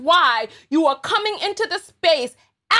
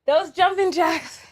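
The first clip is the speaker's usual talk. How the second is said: out of breath. Real, not acted. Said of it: "I'm tired".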